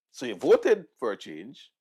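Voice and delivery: ordinary talk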